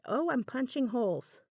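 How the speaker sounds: ordinary delivery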